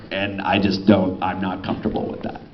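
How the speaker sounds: normal talking voice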